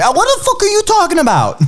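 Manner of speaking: high-pitched, indignant New York flare-up; mock angry outburst. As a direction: act out losing your temper, voice shooting up. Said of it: "Yeah, I'll get angry".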